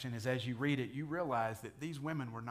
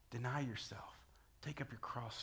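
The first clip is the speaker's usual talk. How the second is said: intimate whisper; preacher voices Jesus's call to the listener in a soft whisper; 6 dB quieter than usual. Acted. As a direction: whisper it close, like a personal invitation.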